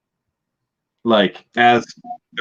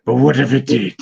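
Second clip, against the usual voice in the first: higher, louder, worried woman's voice; questioning; 4 semitones higher, 5 dB louder than usual. Retold or imitated. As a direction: ask it as a nervous underling. She questions the plan, acted quote.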